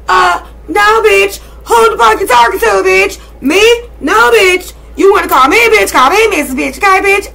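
high, loud sassy shouting; mock fury. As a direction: roast them at full volume. Escalating mock confrontation.

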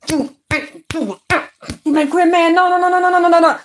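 高八度连喊不不不，语气慌乱; 尖着嗓子连声拒绝，像被逼急了; frantic no. Retold or imitated. Mocking a frantic protest.

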